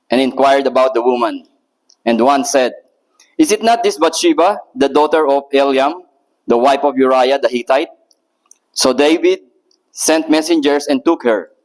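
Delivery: normal talking voice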